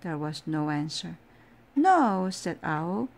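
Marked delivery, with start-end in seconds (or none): 1.7-2.3 high, loud answering shout. Acted. Owl answers himself from upstairs.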